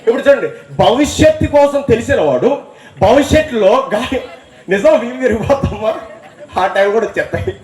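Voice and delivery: high, building sermon voice; fervent preaching. Acted. Preaching crescendo.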